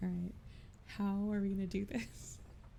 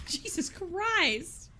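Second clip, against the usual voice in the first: a disbelieving laugh through the words; 10 semitones higher, 4 dB louder, much slower than usual. Real, not acted. Gamer robbed of her pistol, laughing in disbelief.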